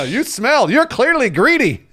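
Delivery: high, slow sneering insult; mock Ollivander. Ollivander insulting a child, high and slow. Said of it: "continuing Ollivander insults".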